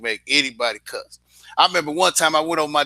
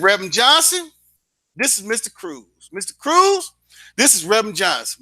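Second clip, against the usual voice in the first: excited; 8 semitones higher than usual. Retold or imitated. Formal introduction to reverend.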